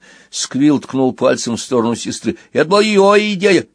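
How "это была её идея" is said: loud blaming shout. Acted. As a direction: yell it, pointing at your sister. Squill shouting, blaming sister.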